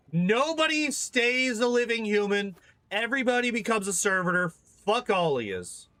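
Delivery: high, fed-up proclamation; exasperated decree. Pretend. Player condemning the whole crew to servitorization, exasperated.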